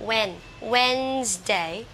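"Wednesday" slowly as "WENZ-day"; corrected pronunciation. Shown as a demonstration. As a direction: build "Wednesday" from "wen", stretched out. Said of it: "đều sai các bạn nha". (đọc đúng "Wednesday" chậm, hai âm tiết)

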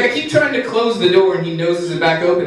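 normal talking voice

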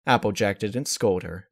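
plain reading voice

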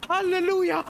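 joyful high cry. Real, not acted. Rejoicing over found gear.

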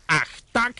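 high, loud sudden exclamation; exclaiming. A narrator's reading. Father exclaiming as he remembers the stamp, loud and high. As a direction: burst out as it suddenly comes back to him. Tata zawołał.

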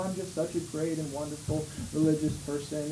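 low, soft, self-satisfied boast; smug hypocrite. Retold or imitated. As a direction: purr your own praise. Hypocrite boasting, then crowd echoes.

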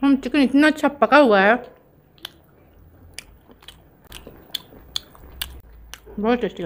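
speaking between bites; talking while chewing. Real, not acted. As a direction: mouth partly full. Seen 0: bit chicken at -2s, speaks chewing, holds bitten piece.

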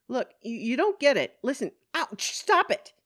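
high, loud, rising to a pained yelp; flustered protest. Acted. Woman arguing with police while girls hurt her, flustered protest. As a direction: argue urgently, breaking into a yelp.

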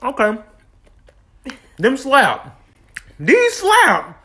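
with food in mouth; talking while chewing. Real, not acted. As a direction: between bites of food. Seen 0: chews and licks lips throughout; bites a sauced fried strip at 3.5.

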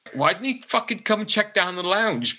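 mock exasperation, how could he not check; exasperated. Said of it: "I was just like".

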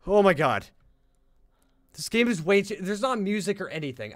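loud, high and rattled; nervous loud complaint; scared exclaiming. Real, not acted. Creeped out in silent game.

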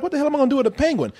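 high, fast exasperated yelp; a panicked what-do-I-do. Retold or imitated. Jim Carrey squawking over a penguin, high and rushed. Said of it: "Jim Carrey's like".

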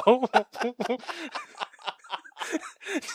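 high, long cackle; helpless laughing fit. Real, not acted. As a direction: crack up at your own impression. Cracking up at own reenactment.

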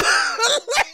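real helpless high laughter; squealing burst of amusement; high-pitched laugh. Real, not acted. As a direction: crack up completely. Cracking up at the clip.

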